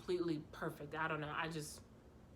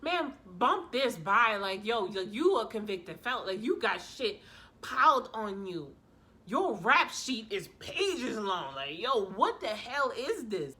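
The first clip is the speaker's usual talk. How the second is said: mildly heated, exasperated protest; retold arguing; 2 semitones higher, 5 dB louder, slower than usual. Retelling a dispute with the convicted boyfriend, retold arguing. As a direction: lean on the key words, mock exasperation. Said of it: "We get into this whole dispute".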